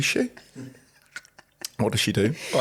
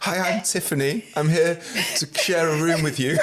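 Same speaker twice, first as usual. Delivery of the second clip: higher, mock-polite introduction through laughter; prim newcomer impression; 5 semitones higher than usual. Host mocking guest as new cellmate, prim impression. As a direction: play the naive new arrival, pitched up.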